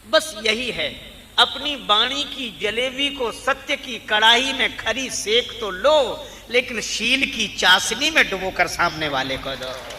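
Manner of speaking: forceful sermon climax; emphatic preaching. Acted. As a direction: drive the moral home at full force, drawing out key words. Preacher drives home the moral.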